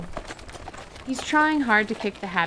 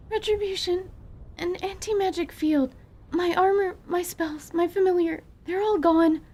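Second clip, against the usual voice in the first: panicked gasp; 10 semitones higher than usual. Acted. Anise panics over lost magic.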